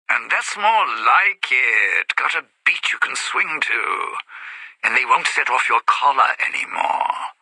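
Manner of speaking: loud voice through a loudspeaker; booming over an intercom; over the speakers. Acted.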